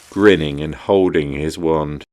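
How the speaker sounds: ordinary delivery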